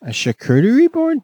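high surprised question. Real, not acted. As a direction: ask it in disbelief.

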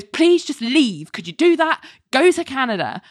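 imploring. Retold or imitated. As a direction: imitate a fed-up man begging her to go. Larry pleads with Jamie to leave.

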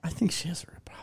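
fast breathy whisper. Real, not acted. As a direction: whisper it like a secret. Whispered agreement.

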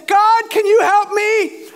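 loud, high, drawn-out mock plea; crying out. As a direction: holler slowly up to heaven, hammed-up desperation. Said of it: "he's crying out".

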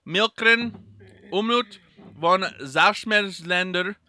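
loud, slow, deliberate German; put-on German reading. Podcaster reading a chocolate label to co-host, hammy German. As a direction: declaim it in a slow German accent.

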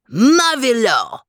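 high, loud, drawn-out cry of delight; delighted exclamation. Acted. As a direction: stretch it out in gourmet rapture. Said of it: "exclaimed Max".

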